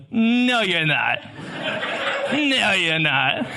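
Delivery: put-on retelling a kid's "no, you're not" through laughter; laughing while quoting. Preacher retelling a boy's lunch taunt, laughing. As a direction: chuckle through the boy's denial. Boy denies he is teacher.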